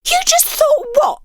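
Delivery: very high, piercing question; shriek. Acted. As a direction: screech it. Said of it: "the girl shrieked".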